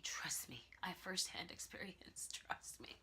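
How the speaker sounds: whispered, very soft aside; confiding whisper. Real, not acted. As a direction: let the audience in on a secret.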